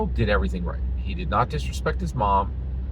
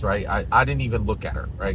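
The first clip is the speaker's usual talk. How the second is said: ordinary talk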